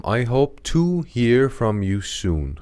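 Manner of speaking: slow repeat. Shown as a demonstration. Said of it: "امیدوارم که به زودی".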